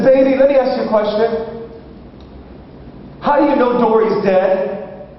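put-on high, careful question to a child; coaxing father. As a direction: imitate a dad gently getting to the bottom of it. Father coaxes answer from daughter.

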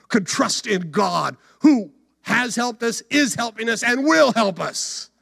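high, emphatic pulpit delivery; rousing sermon climax; impassioned preaching. Real, not acted. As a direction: drive each promise home. Preaches at full force.

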